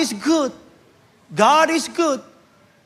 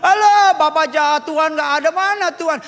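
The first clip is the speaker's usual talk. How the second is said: put-on whiny teen; 5 semitones higher than usual. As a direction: whine like a spoilt kid begging. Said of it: "party itu ada miras".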